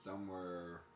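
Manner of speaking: normal talking voice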